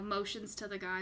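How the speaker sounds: ordinary talk, nothing marked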